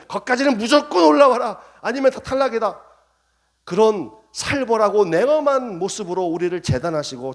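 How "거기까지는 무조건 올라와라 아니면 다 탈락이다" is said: stern verdict. Pretend.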